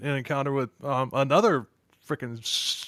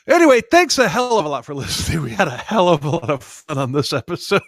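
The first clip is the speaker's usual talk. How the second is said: laughing; 2 semitones higher, 7 dB louder than usual. Real, not acted. Laughing loud sign-off.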